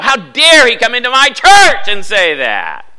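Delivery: high, very loud indignant shout; outraged yell. Retold or imitated. Pastor mad at convention speaker, outraged yell. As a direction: roar it in outrage, words drawn out. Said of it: "I got so mad".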